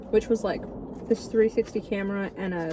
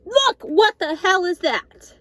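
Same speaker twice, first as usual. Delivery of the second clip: shocked; 8 semitones higher, 5 dB louder, slower than usual. Real, not acted. Shocked at the food.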